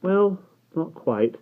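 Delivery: high, slow, reluctant correction; apologetic. A narrator's reading. Will gently correcting Barney, apologetically. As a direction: draw it out apologetically. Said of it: "sounding apologetic".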